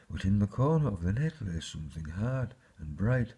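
plain reading voice

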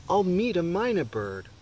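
usual speaking voice

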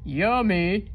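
growled yummy. A narrator's reading. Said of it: "it growls".

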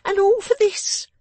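high, loud reproach; indignant scolding. A narrator's reading. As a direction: throw it at him in a high, outraged voice. Miss Wren scolding her father.

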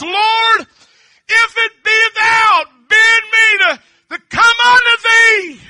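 high, loud call across the water; Peter calling out. Acted. Peter shouting to Jesus from the boat, high and loud. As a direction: shout the plea over the storm.

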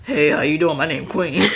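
deep mock-masculine brush-off; put-on deep voice. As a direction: growl it to brush someone off. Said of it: "I'd be like".

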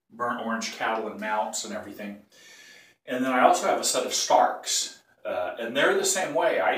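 normal talking voice

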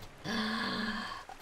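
high, soft breath of amazement; awed gasp. Real, not acted. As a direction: gasp at a hidden detail. Gasping at a build's detail.

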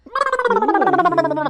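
very long, high, loud drawn-out no; long wailing no. Acted. Drunk husband wheedling his wife for money, wailing no. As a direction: stretch the no out like a pleading wail.

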